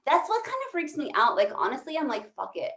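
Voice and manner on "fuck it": usual speaking voice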